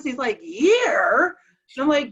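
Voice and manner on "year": rising, drawn-out puzzled query; baffled echo. Retold or imitated. Confused husband asked what year it is, baffled echo. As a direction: swoop up in blank confusion. Husband does not know the word.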